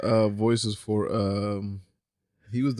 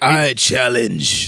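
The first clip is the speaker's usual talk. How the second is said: villain voice; 4 semitones lower, much slower than usual. Retold or imitated. Shredder quote after came back like.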